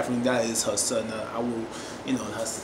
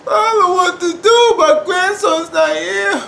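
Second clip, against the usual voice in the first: high, loud acted wail; hammed-up grief-stricken cry; imitating tearful grief; 12 semitones higher, 7 dB louder than usual. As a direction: wail it out as the bereaved grandmother, stretching the end. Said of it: "I was tearing 'cause she's like".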